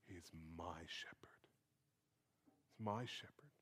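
hushed, intimate whisper; tender near-whisper, lingering on each word; very personal. Retold or imitated. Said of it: "very personally".

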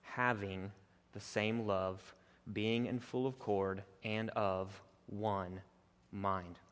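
slow, soft, hushed reading of the verse; quiet, slow scripture reading. Acted. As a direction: read it low and reverent, taking your time.

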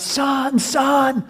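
high, urgent calling for a son; acted panicked father's cry; father yelling out. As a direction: call out desperately into a burning house. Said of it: "the father's yelling out".

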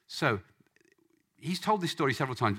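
ordinary talk

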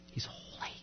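whispered in awe, barely audible; awed whisper. Retold or imitated. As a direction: breathe the words like a secret. Awed realization of the crowd.

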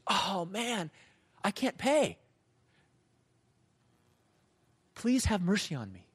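lightly acted begging; pleading. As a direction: play a debtor asking for mercy, mildly desperate. Second servant begs for mercy.

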